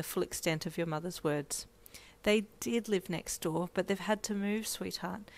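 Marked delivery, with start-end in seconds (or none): 2.2-5.3 gentle. Acted. Mother soothing daughter.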